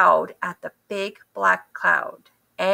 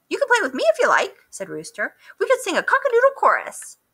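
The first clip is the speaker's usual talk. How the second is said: much higher, fast cheery rooster; 11 semitones higher, much faster than usual. A narrator's reading. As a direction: chirp it out like a proud rooster.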